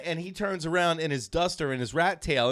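ordinary talk, nothing marked

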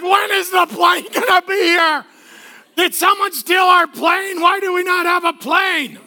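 put-on high, loud whining kid; whiny kid yelling. Kids at the airport whining loudly about their late plane. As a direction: complain like an impatient child stuck waiting. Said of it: "They're just like".

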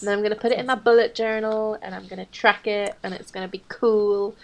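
ordinary talk, nothing marked

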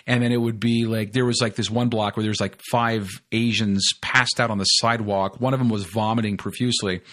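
ordinary talk, nothing marked

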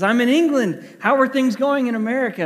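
wife phoning her husband from her trip, upbeat caller voice; cheerful wife on the phone. Retold or imitated. Wife calls husband from England.

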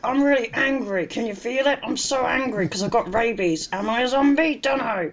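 hammed-up zombie grievance; zombie complaint. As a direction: whinge like a confused, cross zombie. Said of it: "their whole thing was like".

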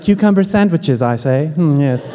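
mock posh British accent, lightly done; a hammed-up English gentleman at tea. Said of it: "God's like a limey".

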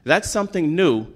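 rising, emphatic preacher's voice; preaching. Acted. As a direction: preach it to the congregation with conviction. Sermon builds to preaching.

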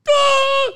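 high mock scream; high yelp. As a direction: let out a cartoon yelp. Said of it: "caller yelps".

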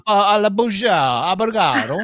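loud, high mock-Italian accent; Portuguese turned Italian. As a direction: read Portuguese with a hammed-up Italian lilt. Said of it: "my Portuguese turned Italian there".

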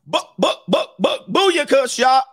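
high mock gunfire chant; spray the words like wild shots; hammed-up booyaka gun noise.

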